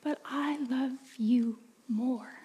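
put-on slow, whispered child's voice; girl whispering as she hands over the necklace. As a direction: give it up in a hushed little whisper.

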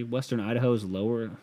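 ordinary talk

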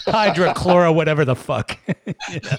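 sputtering laugh through a mouthful; laugh-spraying a drink mid-word; spit take. Real, not acted. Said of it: "I had water come out my nose just now".

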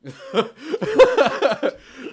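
high burst of laughter; loud laugh. Real, not acted. GM bursting out laughing at the player's sheriff question. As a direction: crack up loudly.

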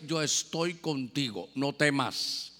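tender reassurance. Acted. Voicing the Lord's comfort.

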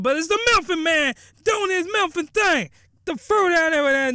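high, excited storyteller's proclamation; hyped outburst. Real, not acted. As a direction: build it up like a big reveal. Hyped recap of the title character.

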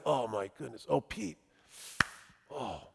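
very soft, sinking lament; hushed dismay. Real, not acted. Preacher laments Peter's denial, hushed dismay. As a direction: drop almost to nothing in dismay.

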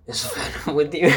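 mock throaty, raspy line reading. Reviewer mocking Michelle Williams's bad line delivery, throaty rasp. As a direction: imitate an actress rasping, close to laughter. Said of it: "like, really throaty, raspy voice".

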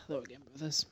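quiet voice loud hiss. Real, not acted. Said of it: "You're so quiet".

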